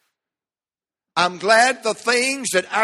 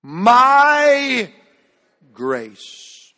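leading the call; 4 semitones higher, 3 dB louder than usual. Real, not acted. Preacher leading the church in saying the text, ringing out. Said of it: "say it with me".

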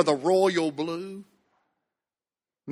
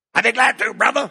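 imitating a church member's odd voice; put-on, pitched-up eager reply; Brother Pate; 5 semitones higher, faster than usual. Church member accepting the pastor's request, imitated voice. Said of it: "That's how he talked".

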